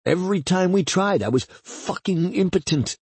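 high, agitated self-disgust; anguished confession. Acted. Narrator confesses failure to lover, bitterly frustrated.

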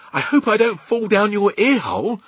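put-on high, loud child's voice; worried little girl. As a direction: call out anxiously as a small girl. Sophie worried at ear hole.

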